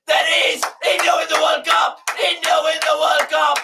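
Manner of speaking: yelling the winning moment, high and loud; ecstatic shout. Real, not acted. Fan watching the final screams as India win, ecstatic shout. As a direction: shout the wicket and the win at full joy.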